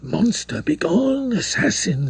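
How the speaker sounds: rising angry outcry, begone jumping high; a girl drives off her attacker in fury; faltering with anger. A narrator's reading. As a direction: spit the words out in horror and rage. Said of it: "faltering with anger and horror".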